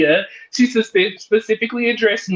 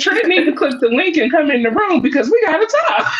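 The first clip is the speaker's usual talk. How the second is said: laughing shout; 7 semitones higher, faster than usual. Real, not acted. Laughing call for Trent.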